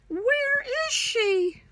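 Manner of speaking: slow, high, crying mock worry; high worried wail. Monkeys crying for their missing sister, hammed-up wail.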